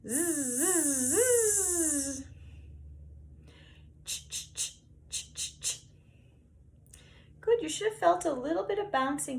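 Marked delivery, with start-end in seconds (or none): 0.6-2.2 buzzing zzz, rising; percussive zzz. Shown as a demonstration. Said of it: "percussive sounds". 4.0-5.8 quiet unvoiced ch pulses; percussive ch. Shown as a demonstration. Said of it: "percussive sounds".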